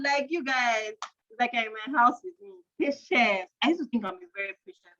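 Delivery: ordinary delivery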